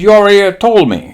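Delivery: high, loud mock-Japanese retort; Japanese admiral. Admiral answering lieutenant, high mock-Japanese retort. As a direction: cut him short in a Japanese accent. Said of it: "He says".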